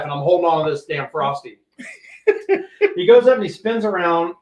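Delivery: ordinary delivery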